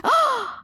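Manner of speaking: sharp gasp, then a high startled oh; shocked gasp. Retold or imitated. The guy on Omegle, stunned by the insult, gasping high. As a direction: gasp like you just got insulted. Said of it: "And the guy's like".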